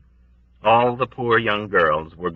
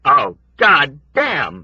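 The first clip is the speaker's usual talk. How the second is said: slow, louder bitter curse; bitter exclamation; 6 semitones higher, 5 dB louder, much slower than usual. A narrator's reading. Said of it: "Yossarian exclaimed bitterly".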